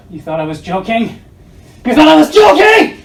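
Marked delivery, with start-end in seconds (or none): 1.8-3.0 shouted high and loud; incredulous shout. Acted. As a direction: yell it in disbelief. Repeated line, second shouted.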